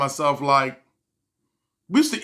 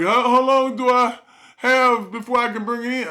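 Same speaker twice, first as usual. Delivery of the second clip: mock worried customer; 7 semitones higher, 3 dB louder than usual. Asking mechanic, higher voice.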